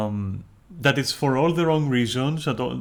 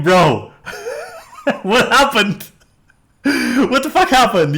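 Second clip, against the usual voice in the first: loud and high, laughing through the words; bursting out laughing at the absurdity; laughing disbelief; 13 semitones higher, 9 dB louder, slower than usual. Retold or imitated. Streamer cracking up at someone dropping their act, loud and high. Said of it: "And you're like".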